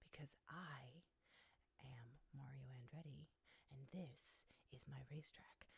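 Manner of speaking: mock-solemn cat speaking low; cat's voice. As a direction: announce it gravely as the cat, softly. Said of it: "And he's like".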